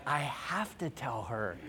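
quietly admitting it to himself; hushed resolve; resigned thought. Retold or imitated. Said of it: "I'm like".

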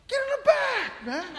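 high, loud mock yell at the line cutter; mock shout. Pastor yelling at a line cutter, mock shout. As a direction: bark it at the guy who cut.

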